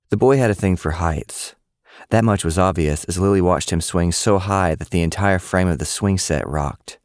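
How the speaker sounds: plain reading voice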